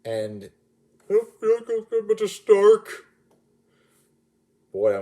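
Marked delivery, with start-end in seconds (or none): 1.1-3.1 put-on high, slow, pleading kid voice; higher, louder and slower, like a frightened teen; dying Spider-Man quote. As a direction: play the scared boy fading in Stark's arms. Quotes Spider-Man's dying line.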